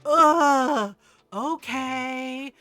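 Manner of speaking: loud mock-kid groan, then drawn-out okay; eye-rolling exasperation. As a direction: give in with a huge huffy groan. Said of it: "did a freaking eye roll".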